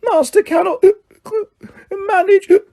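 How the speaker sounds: very high, slow whimpering squeak; high whimpering house-elf voice. A narrator's reading. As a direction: whimper it like a drunk, lost elf. Drunk Winky pleads.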